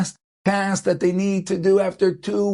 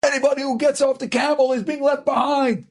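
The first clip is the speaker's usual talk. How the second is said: harsh yell; 7 semitones higher than usual. Retold or imitated. Smuggler driving the exhausted family on, harsh raised yell. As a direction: bark it at them like a ruthless smuggler. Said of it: "berates them and yells at them".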